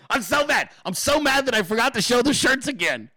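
high, half-laughing mock fury; laughing at his own anger. Real, not acted. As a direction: laugh off how mad you are. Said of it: "I'm so fucking mad right now".

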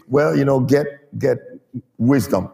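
low, offhand GET WISDOM, trailing down; mumble-it-away indifference, no push on GET; half-hearted. Shown as a demonstration. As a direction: say GET WISDOM as if it hardly matters. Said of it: "that's not gonna fly".

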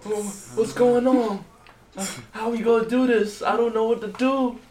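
clueless lieutenant. Retold or imitated. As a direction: play a flustered new lieutenant begging the captain. Imitating lost lieutenants asking captain.

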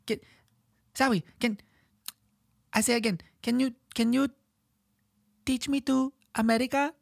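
put-on very high, halting little-kid voice. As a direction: imitate a small Chinese child learning English.

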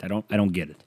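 normal talking voice

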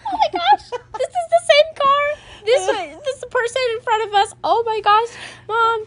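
high, panicked gasp of surprise; shocked. Retold or imitated. Daughter realizing it's Kaylee's mom's car, high and shocked. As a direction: freak out at the realization. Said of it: "I'm like".

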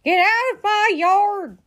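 high, loud, drawn-out yell; storybook outraged holler; angry shout. A narrator's reading. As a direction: order the intruder off furiously. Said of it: "shouted at Mercy".

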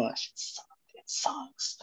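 hushed, confidential whisper; whispered aside. Retold or imitated. Confiding to the guys about the play, whispered. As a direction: whisper it like a secret.

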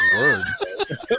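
shrieking laugh bursting out; loud high burst of laughter. Real, not acted. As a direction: explode into laughter mid-sentence. Laughs at the banned word.

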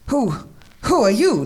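startled character's challenge, read aloud; demanding. A narrator's reading. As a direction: demand it after a false start. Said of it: "demanded Devereaux".

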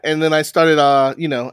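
ordinary talk, nothing marked

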